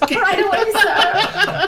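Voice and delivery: laughing, chirpy assistant reply; eager intern. Pretend. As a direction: play the keen intern, giggling.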